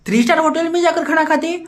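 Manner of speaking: scoffing "star" with a rising tone; mocking echo. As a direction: throw his words back at him in disbelief.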